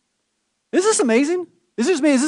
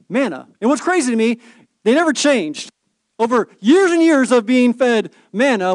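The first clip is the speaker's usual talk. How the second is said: ordinary talk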